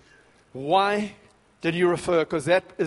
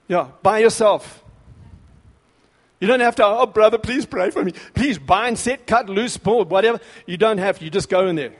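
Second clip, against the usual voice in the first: preaching at full force; 3 semitones higher, 6 dB louder than usual. Real, not acted. Preacher firing up his congregation, full-force preaching. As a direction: thunder it out like a revival preacher. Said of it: "I'm getting carried away".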